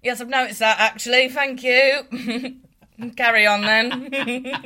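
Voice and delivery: high, loud mock-British retort; British accent. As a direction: put on indignant British politeness, amused. Mock-British retort to being hijacked.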